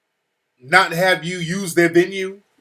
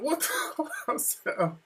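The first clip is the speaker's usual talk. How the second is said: high, soft, drawn-out bafflement; baffled husband, voice up high; retold bewilderment; 11 semitones higher, 9 dB quieter, much slower than usual. Husband reacting to wife's outburst, retold bewilderment. Husband's startled reply to angry wife.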